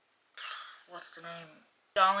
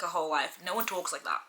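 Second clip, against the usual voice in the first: ordinary talk, nothing marked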